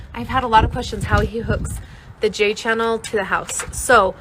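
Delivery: ordinary delivery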